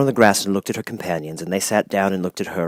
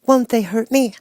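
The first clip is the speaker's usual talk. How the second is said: anxious. A narrator's reading. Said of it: "asked the girl anxiously".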